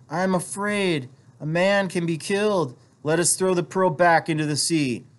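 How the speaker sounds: high-pitched fearful wife's plea; a touch of fear, pitched well up; husky, afraid. A narrator's reading. Wife begging husband to give up the pearl, husky and afraid. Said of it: "she said huskily".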